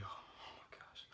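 breathy slow whisper of disbelief. Real, not acted. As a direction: breathe it out under your breath. Exasperated aside mid-rant.

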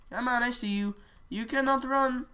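ordinary delivery

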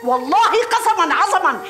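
usual speaking voice

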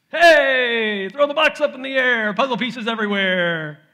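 put-on high, loud excited toddler shout. Dad imitating his toddler son, high loud glee. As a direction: play the gleeful little boy tossing puzzle pieces.

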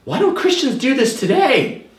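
high, bewildered musing to himself; puzzled inner voice. Retold or imitated. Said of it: "And I was thinking".

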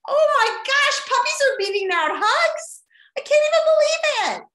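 high, loud astonished outburst; amazed disbelief. Real, not acted. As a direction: squeal it like you can't believe the result. Puppies beating hugs in vote.

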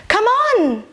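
rally the class with a high shout; urging call. Acted. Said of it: "she urged".

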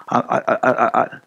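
a lightly acted nervous stammer; mock stutter, barely put on. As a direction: stumble on the I like a frightened Moses. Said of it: "he started stuttering".

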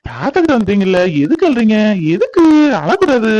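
put-on high grandfatherly baby talk; cooing to baby. Grandfather holding the crying baby, high soothing coo.

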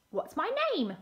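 a hint of a bark on the question; high-pitched doggy question. A narrator's reading. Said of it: "barked the dog".

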